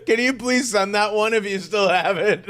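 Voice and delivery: asking through laughter; laughing. Real, not acted. Asking her friend for the clip while laughing.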